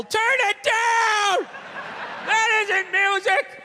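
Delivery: put-on high, drawn-out old neighbour yell; screaming up. Lonely basement dweller to the younger couple upstairs, screaming up. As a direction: holler it slowly up through the ceiling. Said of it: "just screaming up at the younger couple".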